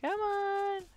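stretched, very high teasing "Come on!"; taunting call. Acted. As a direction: draw it out high to bait him. Rat taunting the monster to chase.

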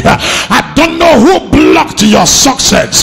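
building preacher's declaration. Acted. Preacher declaring deliverance to the church, building to shouts. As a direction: climb steadily until you're declaring it.

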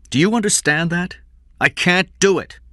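high, loud, agitated refusal; heated refusal. A narrator's reading. Employer tells desperate father he can't pay, heated refusal.

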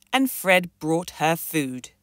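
usual speaking voice

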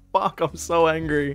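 usual speaking voice

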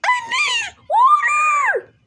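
very high, fast shriek; squeaky yelling. Acted. Chef insulted, shrieking very high.

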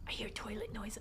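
hushed, nervous whisper; frightened breathy hush; scared whisper. Real, not acted. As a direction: whisper so nothing hears you. Whispering in horror game.

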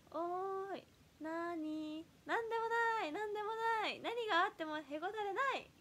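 high, bouncy idol catchphrase voice. Shown as a demonstration. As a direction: chirp the catchphrase like a stage idol. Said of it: "キャッチフレーズを".

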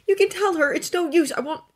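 high, loud irritated snap; snapped. A narrator's reading. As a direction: cut off mid-protest. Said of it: "Draco snapped".

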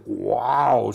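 lautes, tiefes Löwen-„Wow“; brüll es wie ein Löwe aus dem Werbespot; Löwenschrei. Retold or imitated. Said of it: "schrie dann ein Löwe". (gespieltes lautes Löwengebrüll)

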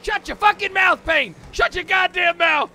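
high, very loud, drawn-out roar; mock furious scream. Gamer silencing his friend as a joke, mock furious scream. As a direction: scream your friend down, stretching the words.

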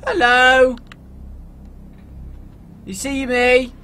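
loud high hello; calling out. Acted. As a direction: hail the car from afar. Hailing a car.

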